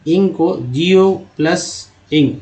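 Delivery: जी ओ plus इंग spelled slowly; slow spelling-out. Shown as a demonstration. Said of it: "इंग को क्या बोला जाता है". (धीरे-धीरे वर्तनी बताना)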